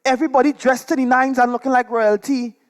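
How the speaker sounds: ordinary delivery, nothing marked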